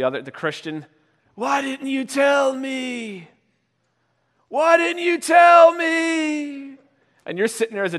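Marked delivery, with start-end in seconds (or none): 1.3-3.3 high, slow drawn-out lament; hammed-up, stretched-out reproach; mock dying wail. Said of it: "he starts to see Jesus". 4.5-6.8 very high, loud, long-drawn lament; over-the-top doomed cry; louder mock dying wail. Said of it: "he starts to see Jesus".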